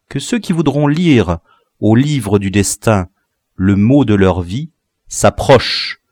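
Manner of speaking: ordinary delivery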